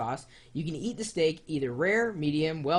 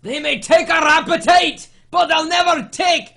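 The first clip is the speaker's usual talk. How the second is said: much higher, loud rallying shout; Braveheart battle cry; 13 semitones higher, 14 dB louder than usual. Pretend. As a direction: roar it like a Scottish war chief before battle. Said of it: "Oh, that was horrible".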